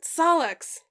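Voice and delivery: calling out. A narrator's reading. Dave calling out to friend.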